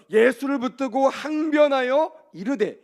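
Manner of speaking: high, loud, drawn-out verse quote; emphatic scripture quote. Acted. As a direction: hammer out the verse slowly. Re-reads verse to stress Peter's protest. (성경 구절을 높고 크게 강조하며 인용)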